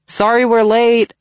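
high, loud, drawn-out apology; calling out. A narrator's reading. Zoe calling ahead as she walks in, loud. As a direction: call it to the next room.

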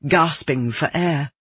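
plain reading voice

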